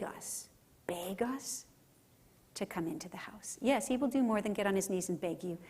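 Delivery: soft, reverent whisper; preacher whispering God's love to the congregation. Real, not acted. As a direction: hush the room and whisper it. Preacher's hushed climax about God.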